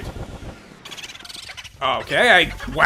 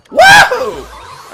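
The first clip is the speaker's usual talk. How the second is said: excited whoop; 14 dB louder than usual. Real, not acted. Cheering as he wins.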